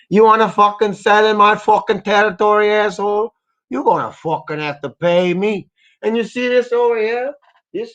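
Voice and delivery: a hammed-up Mexican mobster shaking down a shop; Mexican mafia boss. Streamer voices a Mexican mafia boss demanding money, menacing accent. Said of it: "I made that character Mexican".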